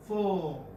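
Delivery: "Fo" left open on the vowel; no final r. Shown as a demonstration. Teacher repeating how fur ends, no final r. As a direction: say "Fo" with no r sound to close it. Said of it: "This is how it ends".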